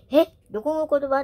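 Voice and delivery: 友達の驚いた声をまねて; surprised friend. Retold or imitated. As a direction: play the friend, lightly startled. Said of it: "って".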